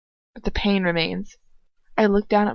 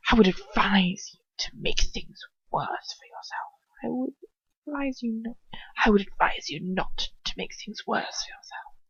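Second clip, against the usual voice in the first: soft, unvoiced threatening whisper; hushed, sinister warning; menacing whisper; 2 semitones higher, 5 dB quieter than usual.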